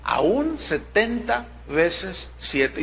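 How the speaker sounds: slow emphatic. Acted. Stressing the answer Jesus gives.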